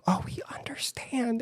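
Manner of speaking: soft, high, whispery cooing; mock understanding, almost all air; hushed sympathy. Other people reacting to the story, hushed sympathy. Imagined sympathetic listeners quoted.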